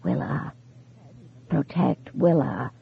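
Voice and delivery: soft, breathy, trembling warning; whispery vibrating voice. A narrator's reading. Ghost warns his widow over the phone, whispery and trembling. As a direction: let the voice tremble and fade.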